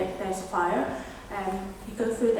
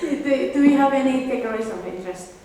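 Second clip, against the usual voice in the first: louder, fast, rushed restart; nervous; 2 semitones higher, 7 dB louder than usual. Real, not acted. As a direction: push through it quickly and louder. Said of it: "Sorry, I'm a bit nervous today".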